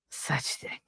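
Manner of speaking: soft voiceless whisper; whispered aside. Real, not acted. Mother griping about her teenage son, whispered aside.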